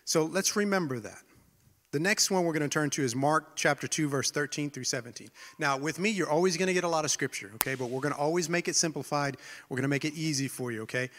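ordinary talk

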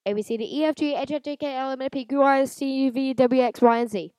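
rapid sing-song ABCs; fast recitation. Shown as a demonstration. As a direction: rattle off the alphabet quickly. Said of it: "I did it if I do it fast".

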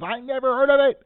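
high, loud mock yell of the driver. Driver yelling at a tourist, mock yell. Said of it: "he yells".

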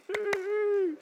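very high, loud squealed cheer; retold whoop of joy; high-pitched yay. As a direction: squeal a long, drawn-out yay. Retelling his reaction to good news.

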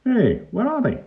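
very high, startled question; startled policeman. A narrator's reading. Pongo looks at the empty table, high and startled. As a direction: exclaim at the missing evidence. Policeman finds oranges gone.

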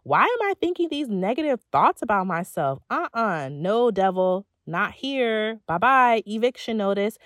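loud, sassy telling-off of the devil; sassy dismissal. Shown as a demonstration. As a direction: shoo the negativity out with attitude. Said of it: "Tell yourself".

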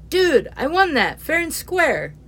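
high, loud, whiny objection; indignant protest. Acted. Neil protesting to Kane over the snatched twenty, high and loud. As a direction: protest loudly that it's yours.

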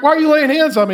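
wife pushing back at husband, mock alarmed; alarmed question. Wife demanding why husband lays hands, high alarmed.

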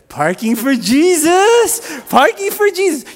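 high, drawn-out joyful holler; Mark Anderson. Retold or imitated. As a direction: imitate a zealous yell across a parking lot. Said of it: "and he'll be like".

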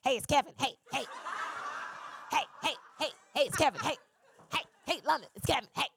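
lightly imitated jaunty phone greeting; playful impression of a caller announcing himself; Kevin Hart. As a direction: repeat short, choppy heys like a caller. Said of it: "He's like".

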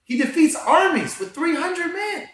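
rising into a full excited preach; fired up. Real, not acted. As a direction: tell it like you're lighting up the room. Excited preaching about God.

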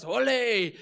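high, loud mock cheer; crowd shout. Preacher imitating everyone hailing the trumpeter, shouted cheer. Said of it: "everyone shouts".

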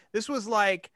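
ordinary delivery

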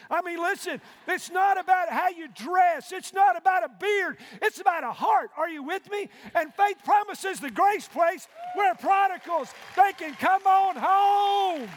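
preaching at full force. Acted. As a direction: ring it out to the rafters. Preaching climax with shouts.

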